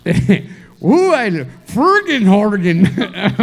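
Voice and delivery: slow, high mock-Swedish gibberish; bad Swedish accent. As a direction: ham up a silly Swedish accent. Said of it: "That's not a good Swedish accent".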